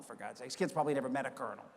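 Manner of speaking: ordinary delivery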